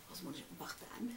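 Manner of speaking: quick breathy whisper; hurried whisper to herself. Real, not acted. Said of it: "si je parle tout bas".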